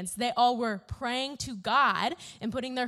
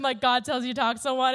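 usual speaking voice

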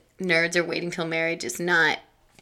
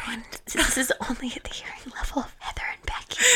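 breathy whisper to friends; stage whisper; 4 semitones higher, 6 dB quieter than usual. Acted. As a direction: whisper it like a secret greeting. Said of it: "a whisper shout out".

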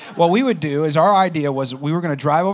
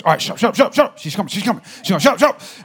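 higher, louder frantic shushing; urgent mock hushing; 4 semitones higher, 6 dB louder than usual. Friend warns the guy hiding in trunk, urgent hushing. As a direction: rattle it off like a panicked accomplice.

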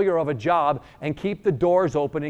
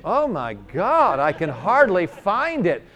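higher, very slow, drawn-out amazement; amazed healed woman; 5 semitones higher, 4 dB louder, slower than usual. Retold or imitated. Healed woman testing her back with stretches, drawn-out amazement. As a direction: stretch out every word in mock wonder. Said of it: "these stretches. She goes".

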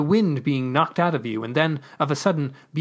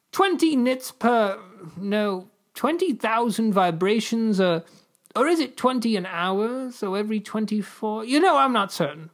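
1 unsure fumbling; 7 semitones higher than usual. Acted.

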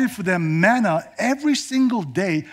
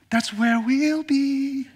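higher and slow, dreamy; lightly voiced Jasmine; 8 semitones higher, much slower than usual. Jasmine answering Aladdin, higher and slow. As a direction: answer like a smitten princess.

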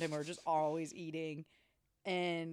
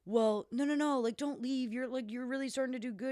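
higher, louder coaxing; pushy gambler; 7 semitones higher, 3 dB louder than usual. Retold or imitated. Corman coaxing Nick to keep playing. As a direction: coax him to stay at the table.

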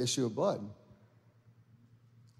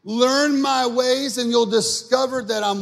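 high, loud, drawn-out proclamation; sustained, raised preacher's voice; preaching Jesus' words; 9 semitones higher, 7 dB louder, slower than usual. Acted. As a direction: call it out slowly at full preaching pitch. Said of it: "He says this".

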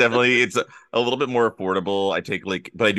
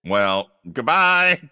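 curt dismissal; 5 semitones higher, 5 dB louder, slower than usual. Acted. Mock hanging up.

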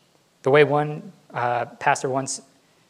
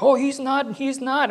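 put-on high, loud protesting child voice; whining kid; 13 semitones higher, 5 dB louder than usual. Child denying to the parent, high whiny protest. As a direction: squeal it like a kid tattling on his brother.

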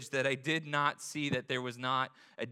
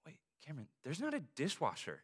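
soft sudden realisation; hushed worry to his wife; hushed alarm; 7 dB quieter, faster than usual. Retold or imitated. Husband in the ER telling his wife, hushed alarm. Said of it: "I'm like".

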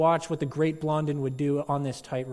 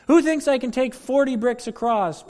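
higher, louder barker's call; showman's challenge; 9 semitones higher, 8 dB louder than usual. Acted. Daredevil dares the crowd, loud showman call. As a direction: egg the crowd on. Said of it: "And he says".

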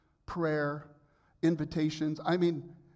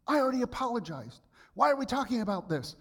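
imitating his own defensive reply, higher; defensive outburst; 9 semitones higher, 3 dB louder, faster than usual. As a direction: play back a touchy, put-out self. Acting out his defensive reaction.

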